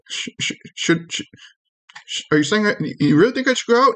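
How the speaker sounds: stammering, high little voice; nervous tiny creature. Acted. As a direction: stumble over it in a small, high voice. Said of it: "he goes".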